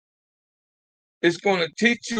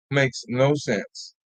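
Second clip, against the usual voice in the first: very slow and a little softer; slow disbelieving repeat; 6 semitones lower, 5 dB quieter, much slower than usual. Real, not acted. Host repeating to absent viewers, slow and soft. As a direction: let it sink in, word by word.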